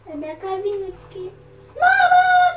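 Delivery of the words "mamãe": high, loud cry of Mamãe; calling out. Pretend. Child in game calls for mom, loud high cry. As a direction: shout for mom in play.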